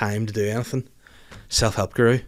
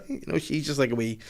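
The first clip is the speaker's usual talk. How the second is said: normal talking voice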